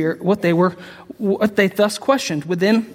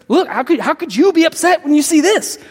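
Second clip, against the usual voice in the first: preacher lifting the question to the crowd; fervent rhetorical question; rising passion; 7 semitones higher, 4 dB louder than usual. Real, not acted. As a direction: voice climbs high and pushes.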